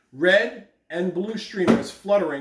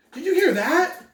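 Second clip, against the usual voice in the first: put-on excited child; 11 semitones higher, 3 dB louder than usual. Child hears drum.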